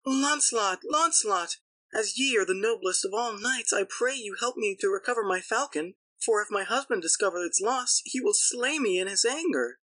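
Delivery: very high-pitched lady's plea; crying fair lady. A narrator's reading. Lady begs a knight to recover her lost falcon, high pleading cry. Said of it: "who cried".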